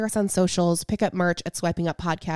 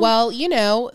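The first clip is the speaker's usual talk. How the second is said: ordinary delivery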